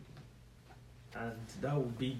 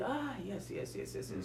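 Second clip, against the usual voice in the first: mock easy agreement; 7 semitones higher, 4 dB quieter than usual. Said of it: "and you say".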